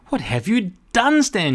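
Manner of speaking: high, loud cross outburst; crying out. A narrator's reading. Boss confronts Stan, high and cross. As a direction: scold him in shock. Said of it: "she cried".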